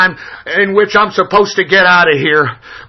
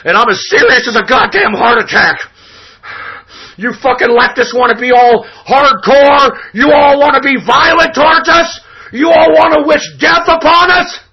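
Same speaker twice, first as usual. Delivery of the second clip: heated shout, climbing higher as it goes; angry shouting; 7 semitones higher, 4 dB louder than usual. Real, not acted. Radio host accusing leftists of violence, angry shouting. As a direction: build the outrage into a high yell.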